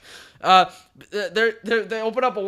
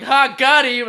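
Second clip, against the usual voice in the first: gloating; 7 semitones higher, 10 dB louder, slower than usual. Retold or imitated. Villain's gloating in a retold fight.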